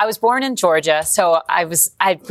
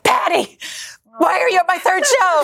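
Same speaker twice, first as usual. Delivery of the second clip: starstruck disbelief; 13 semitones higher than usual. Retold or imitated. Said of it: "and I was like".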